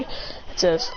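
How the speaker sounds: ordinary delivery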